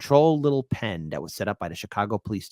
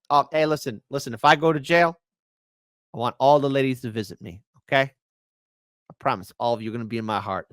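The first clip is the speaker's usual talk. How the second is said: ordinary delivery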